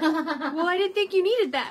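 laughing through the quote. Retold or imitated. Wife retells her husband's excuse about the soap, laughing. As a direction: giggle out his lame excuse.

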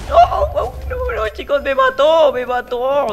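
high frantic cries of no; panicked yelling. Real, not acted. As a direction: yell in panic as you fall. Panicked game death cries.

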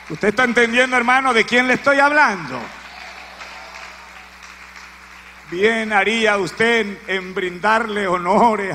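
loud pressing appeal; preaching at full force. Real, not acted. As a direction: drive it home to the listeners.

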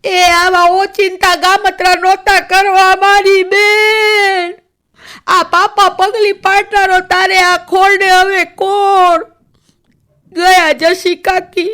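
high, slow, loud keening wail; loud high wail of village women mourning the dead woman; wailing lament. Acted. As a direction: draw out each word in long mourning cries. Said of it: "લાંબા સાદે રોવાનું ચાલુ કર્યું".

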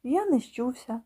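ordinary delivery, nothing marked

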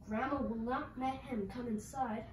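usual speaking voice

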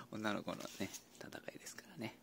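whispered aside. Real, not acted. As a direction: hush it like a secret. ひそひそ話. (ひそひそ声で)

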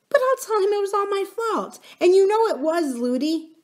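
put-on high, insistent child's voice; small girl protesting loyally; little princess. Princess taking the blame for her nurse, insistent.

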